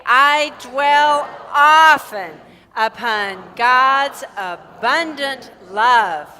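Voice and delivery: slow, loud, drawn-out affirmation; group affirmation. Shown as a demonstration. Minister leads congregation in affirmation, slow and loud. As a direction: proclaim every word slowly and fully. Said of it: "Let's affirm it again".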